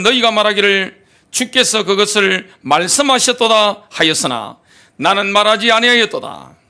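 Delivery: ordinary talk, nothing marked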